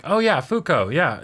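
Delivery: a quick excited put-on Sunohara; character voice. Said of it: "And he's like".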